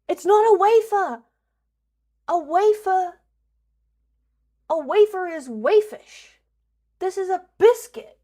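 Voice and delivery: loud, high indignant rant; mock outrage. Real, not acted. Streamer arguing with chat over wafers, loud mock outrage. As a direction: rant at chat like it really matters. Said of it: "I'm getting mad about this".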